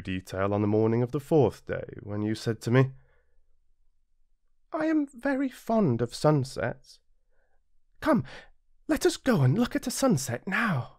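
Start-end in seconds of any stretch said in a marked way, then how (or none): 4.7-10.9 put-on high, light boyish voice, eager; a small prince asking his friend eagerly; little prince. Narrator voices the little prince, high and eager.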